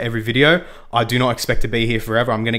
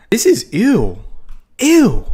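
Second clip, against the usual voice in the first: higher, louder squeal of disgust; 10 semitones higher, 7 dB louder, much slower than usual. Real, not acted. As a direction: recoil at the ugly world. Disgusted at a weird world.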